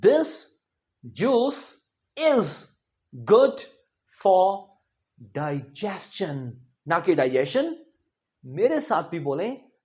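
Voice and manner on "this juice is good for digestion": each word spoken apart with pauses; slow model sentence. Shown as a demonstration. Teacher dictates an English sentence word by word. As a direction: read word by word for students to follow. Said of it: "एक sentence देखें".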